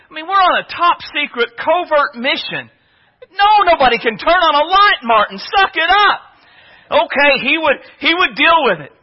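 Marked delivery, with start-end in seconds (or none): 3.3-6.2 high, loud, strained retort; acted urgent scolding, pitched high; exasperated rebuke. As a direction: snap back at a whining comrade. Squad hisses back at panicking soldier.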